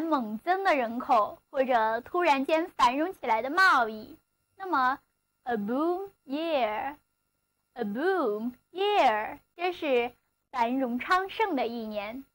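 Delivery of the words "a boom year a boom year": slow model phrase. Shown as a demonstration. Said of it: "teacher models the phrase slowly".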